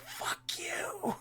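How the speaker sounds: whispered baby impression; hushed, squeezed-out baby voice; voiced baby. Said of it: "and the baby just goes".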